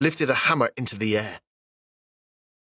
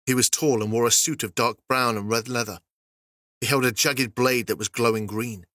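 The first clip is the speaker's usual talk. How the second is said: ordinary delivery, nothing marked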